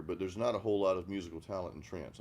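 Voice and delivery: normal talking voice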